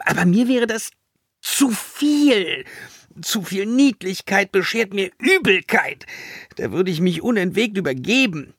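high, theatrical nausea; dramatic, drawn-up revulsion; exaggerated disgust. A narrator's reading. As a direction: complain as if cuteness makes you queasy. Villainess nauseated by cuteness.

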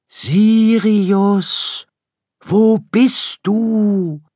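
high, drawn-out search call; distant calling. Acted. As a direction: parents calling far across the forest. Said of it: "hörte er sie weit entfernt rufen". (lang gezogenes Rufen von weit weg)

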